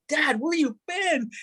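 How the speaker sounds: high, drawn-out plea; slow, high-pitched acted anguish; retold bawling. To his long-absent dad at the reunion, pleading. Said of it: "I'm bawling and just like".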